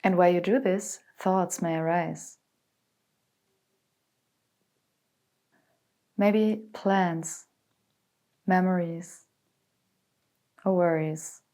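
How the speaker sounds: calm, unhurried meditation voice with long pauses; guided meditation. Acted. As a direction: guide them gently into stillness, letting phrases settle. Leads a guided body scan meditation.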